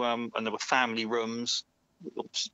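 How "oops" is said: ordinary talk, nothing marked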